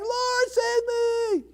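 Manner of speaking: high, slow, desperate cry; cry for help. A narrator's reading. Peter sinking in the sea, desperate cry to Jesus. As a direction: plead as you sink. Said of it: "he cried, saying".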